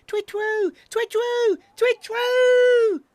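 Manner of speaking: high owl hoot building to a shout; escalating baby owl twit-twoo. A narrator's reading. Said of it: "got louder and louder and louder".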